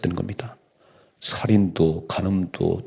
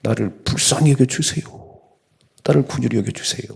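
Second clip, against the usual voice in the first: higher, strained cry of 불쌍히; desperate higher cry, 나를 불쌍히 여겨주세요 pushed out; crying out loud; 4 semitones higher than usual. Retold or imitated. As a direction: blind man shouting for mercy. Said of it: "소리 소리 질렀습니다".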